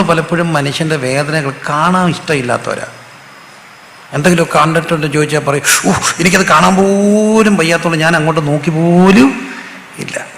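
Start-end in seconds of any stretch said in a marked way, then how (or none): 5.9-10.3 put-on squeamishness, stretching the words; mock squeamish refusal. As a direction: act out someone who can't bear to look. Acting people who avoid suffering.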